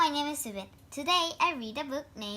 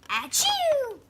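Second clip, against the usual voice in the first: a big hammed-up sneeze; squealing mock sneeze; acted sneeze; 12 semitones higher than usual.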